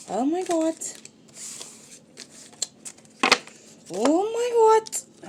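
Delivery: high, loud "oh my God"; astonished exclamation. Real, not acted. Card reader reacting to the messages coming out, loud high astonishment.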